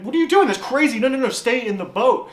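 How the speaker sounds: high, loud, incredulous outburst; alarmed protest. Acted. Onlooker to someone jumping out of a boat in a storm, alarmed protest. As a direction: urgently stop someone doing something crazy.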